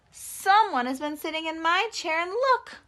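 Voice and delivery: high, loud, slowing to a wail; upset baby bear voice. Acted. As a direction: cry out like a cub whose chair is broken. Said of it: "Baby Bear said".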